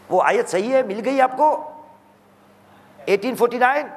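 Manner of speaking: normal talking voice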